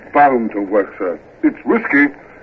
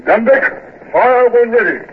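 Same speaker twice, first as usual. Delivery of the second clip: shouted order; 9 semitones higher than usual. Acted. Ordering guns to fire.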